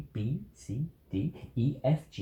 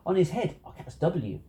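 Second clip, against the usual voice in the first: ordinary delivery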